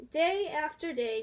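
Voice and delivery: ordinary delivery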